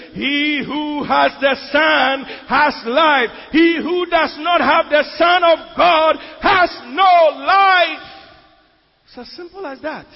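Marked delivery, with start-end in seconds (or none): 0.1-8.0 slow, loud preaching, pitched up; full force preaching. Acted. Preacher to congregation, loud slow preaching. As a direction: drive the verse home from the pulpit.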